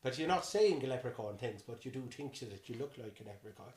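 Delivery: put-on leprechaun-like Irish; Irish accent, easing down at the end. Shown as a demonstration. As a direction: settle into an Irish brogue. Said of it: "you're in your Irish".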